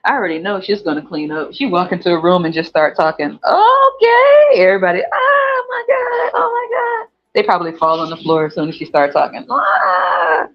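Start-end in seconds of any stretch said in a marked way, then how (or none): 3.4-7.1 high, slow screaming oh my God; swooning crowd. Retold or imitated. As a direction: squeal like a fan.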